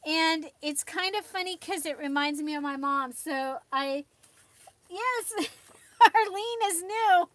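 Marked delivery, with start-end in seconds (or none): 4.9-7.4 delighted. Real, not acted. Delighted announcing grandma joined.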